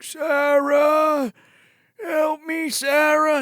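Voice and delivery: high, drawn-out mock call for help; imitated calling out. Paul calling down to Sarah, hammed-up calling out. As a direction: call her name in a high put-on voice. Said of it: "Paul starts calling out to her".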